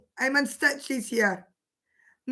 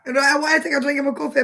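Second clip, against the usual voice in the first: ordinary talk, nothing marked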